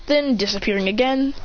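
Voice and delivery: plain reading voice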